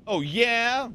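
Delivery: high, rising, daring oh yeah; escalating challenge. Real, not acted. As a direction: throw the dare back, pitched right up. Repeats challenge before demonstrating yell.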